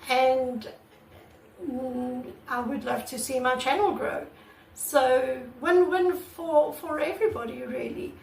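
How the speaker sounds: usual speaking voice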